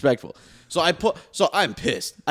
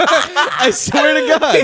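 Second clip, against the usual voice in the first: slow, laughing oath; half-laughing insistence; 9 semitones higher, 5 dB louder, much slower than usual. Real, not acted. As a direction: swear it while cracking up.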